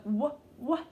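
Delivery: "w" said as a short "wuh", twice; letter sound demo. Shown as a demonstration. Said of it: "w sound".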